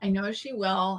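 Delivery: ordinary talk, nothing marked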